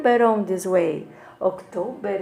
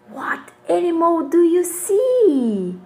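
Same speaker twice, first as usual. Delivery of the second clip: ordinary talk, nothing marked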